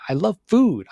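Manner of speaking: eager foodie announcement; bright declaration. Shown as a demonstration. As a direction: say food with relish. Contrasting takes of the same line.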